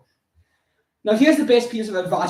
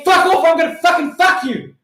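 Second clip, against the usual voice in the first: high, loud acted outburst; explosive mock-furious comeback; defiant shouting; 9 semitones higher, 6 dB louder than usual. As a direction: shout them down at full pelt. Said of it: "And I say".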